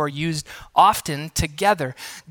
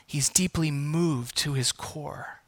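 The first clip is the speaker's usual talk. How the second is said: deeply said slow and soft, falling to a hush; soft, slow emphasis; 3 semitones lower, 9 dB quieter, slower than usual. Acted. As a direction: linger tenderly on deeply. Repeating key phrase softly.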